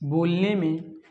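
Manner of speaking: slow, low, soft dictation; word by word with long pauses, quietly. Shown as a demonstration. Teacher dictating the pluta vowel definition, slow dictation.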